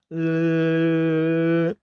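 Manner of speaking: long held cheeky "uhh"; tongue-out noise. Acted. Witch taunting the friends, tongue-out noise. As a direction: drone it with tongue stuck out. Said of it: "stuck out her tongue".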